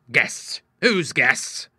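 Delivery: father's indignant outburst; angry cry. A narrator's reading. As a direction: demand it furiously. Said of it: "he cried angrily".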